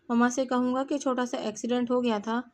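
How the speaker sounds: ordinary delivery, nothing marked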